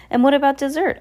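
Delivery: captain bellowing about dessert; eager cry. Acted. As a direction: call out for dessert, pleased. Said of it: "the captain cried".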